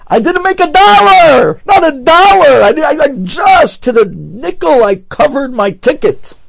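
high, loud, slow cry of not a dollar; exasperated outcry. Retold or imitated. Rosh Kolel complains to the Chazon Ish, loud exasperated cry. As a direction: vent the frustration, pleading.

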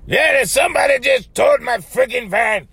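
high, angry mock shout. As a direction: imitate a furious man yelling into a phone. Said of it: "he's like angry".

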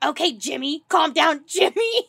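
loud, rising retold heckle breaking into laughter; mock calming shout. Friends telling her to calm down, mock shout. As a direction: imitate friends teasing over the top.

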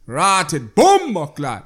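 loud, drawn-out Jamaican exclamation; exaggerated Jamaican accent, punched hard. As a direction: burst out with hardcore Jamaican patois. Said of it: "That's hardcore for Jamaicans".

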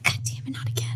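soft hushed groan of defeat; a quiet playful curse; whispered mock frustration. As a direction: mutter it under your breath, fed up.

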